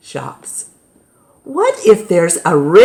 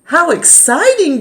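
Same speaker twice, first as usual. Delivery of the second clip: excited; 10 semitones higher than usual. Acted. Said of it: "Grandma says".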